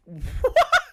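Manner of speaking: sudden high cackle of real laughter; loud, high laugh at a ridiculous line; high burst of laughter. Real, not acted.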